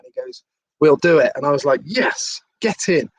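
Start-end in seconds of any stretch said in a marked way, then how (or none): 0.8-1.3 louder, firm promise; imitating a loud, decisive yes; emphatic promise. Ron agreeing to the interview. 1.8-3.1 retold triumphant cheer; celebrating. Celebrating the agreed interview.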